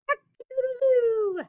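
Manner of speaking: rooster crow. Acted. Teacher reading the farm book, a loud rooster crow.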